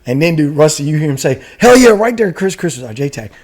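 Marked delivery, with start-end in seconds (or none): 1.6-3.3 louder, higher imitation of a battlefield call; fired-up shout, re-enacted; excited callout. Gunner calling the air controller, excited.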